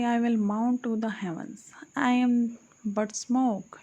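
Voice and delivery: plain reading voice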